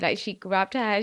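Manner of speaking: ordinary talk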